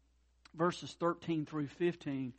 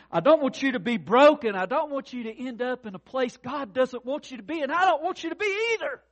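loud, higher heartfelt plea; pleading preaching; 9 semitones higher, 13 dB louder than usual. Acted. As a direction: beg them not to be broken. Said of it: "That's the plea here Paul is saying".

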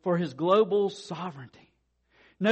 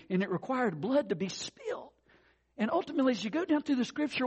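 usual speaking voice

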